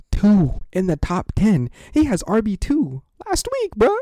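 high, incredulous courtroom rant; exasperated protest. Pretend. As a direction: hammer the point, voice climbing high. Exasperated argument before mock judge.

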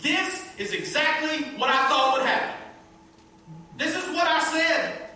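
loud, high, indignant protest; angry complaint. Acted. Jonah venting his anger at God, loud and high. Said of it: "He takes his anger and goes to God".

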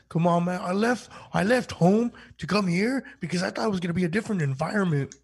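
loud, high, hammed-up complaint; mock protest. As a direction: plead indignantly as the wronged recruit. Acted protest to a dad-like superior.

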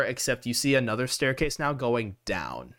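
ordinary talk

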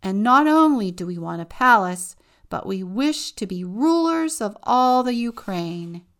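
loud greedy demand, rising on rulers. Acted. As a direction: proclaim it like power-hungry parents. Greedy parents demand more.

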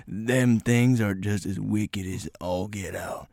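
hushed, slow and low mock hillbilly warning; whispered country drawl. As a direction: breathe it out slowly in a rural drawl. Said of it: "talking like that".

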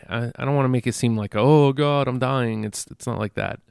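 normal talking voice

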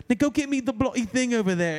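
put-on an actor's British accent breaking through; imitated British accent slip. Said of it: "his accent and shit would go".

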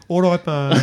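put-on Birmingham accent. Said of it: "I was gonna say Birmingham".

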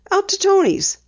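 put-on light girl voice for Penny; Penny, lightly voiced. A narrator's reading. Penny telling her dad she'll drive, lightly voiced girl.

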